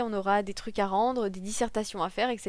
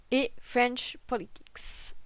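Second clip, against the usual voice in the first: slow, careful French-accented English; much slower than usual. Shown as a demonstration. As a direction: say French Politics with a self-mocking English accent. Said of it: "on remerciera mon magnifique accent anglais". (accent anglais appuyé, lent et maladroit)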